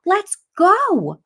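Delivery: high, loud retold demand to move; urgent shout. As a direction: shout it as a frantic mother would. Mother urging ambulance to leave.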